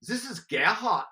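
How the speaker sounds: hammed-up German accent for a spy ploy; German businessman on the phone in a mock German accent. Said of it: "My go-to was German".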